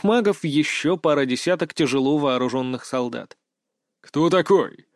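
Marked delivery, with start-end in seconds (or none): 4.0-4.9 booming metallic robot; loud metal-filtered challenge. Acted. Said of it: "громкий и металлический голос". (громкий металлический голос)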